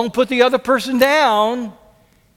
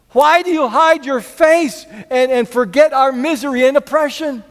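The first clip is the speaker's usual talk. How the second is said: raised, pleading cry to God; pleading lament; 6 semitones higher, 4 dB louder than usual. Acted. Pleading psalm lament read aloud.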